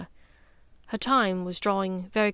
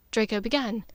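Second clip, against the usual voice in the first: plain reading voice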